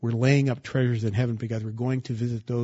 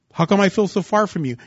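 loud, higher cry to God; anguished plea; 6 semitones higher, 6 dB louder than usual. Acted. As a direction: cry out where are you. Believer's plea voiced.

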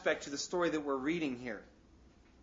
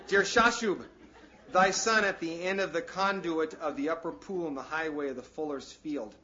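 scripture reading; 6 dB louder than usual. Acted.

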